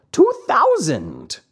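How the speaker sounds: slow, sly echoing cat voice. A narrator's reading. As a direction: echo it slowly like a sly cat. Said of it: "repeated the cat".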